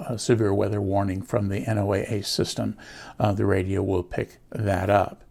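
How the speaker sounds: ordinary delivery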